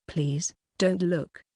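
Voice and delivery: ordinary delivery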